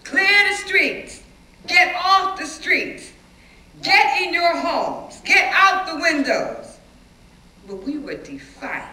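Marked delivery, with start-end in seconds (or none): 0.0-6.8 slow, loud, higher soldier's commands; slow, loud shouted commands through a bullhorn; bullhorn order. Retold or imitated. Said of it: "soldiers came through the blocks with the bullhorns".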